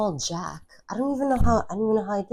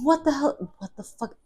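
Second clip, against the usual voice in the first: retold angry outburst; 11 semitones higher, 4 dB louder than usual. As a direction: act out being pissed off and incredulous. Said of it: "I was pissed".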